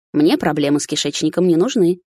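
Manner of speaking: ordinary delivery, nothing marked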